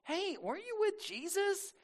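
put-on high, soft girl's voice; lightly comic schoolgirl questioning; middle school girl. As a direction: pitch it way up as a young girl. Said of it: "middle school girl walks up".

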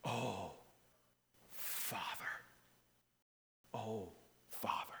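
soft whispered "Oh, Father"; tender whispered prayer; whispered cry. Shown as a demonstration. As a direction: breathe it out as a hushed plea. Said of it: "instinctively cries out".

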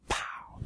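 hushed, voiceless "pow"; imitated slingshot shot. Preacher mimics the slingshot firing, hushed imitated pow. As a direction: puff out a soft shot noise.

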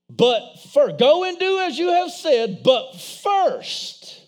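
"first" punched hard each time; stress on first. Acted. Preacher to church, pressing on "but first". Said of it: "underline that word in your Bible".

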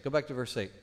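ordinary delivery

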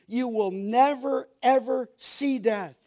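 NEVER and EVER each stretched and stressed; emphatic never ever. Shown as a demonstration. Preacher repeating the gospel promise to listeners, emphatic stress. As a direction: drive home "never" and "ever", drawing both out. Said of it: "It's emphatic, we call it".

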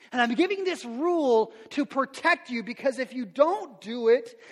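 3 plead it, building with each repeat; 1 preaching at full force. Acted. Voicing God's words, preaching.

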